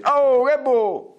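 high, drawn-out comic retort; hammed-up talking mirror; mirror's rude reply. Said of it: "lo specchio rispose".

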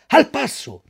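high, loud ringmaster order; shouted command. Acted. Director shouts to the donkey in the ring, loud. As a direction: crack it out like a whip. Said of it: "non gli gridò".

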